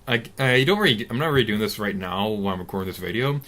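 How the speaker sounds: normal talking voice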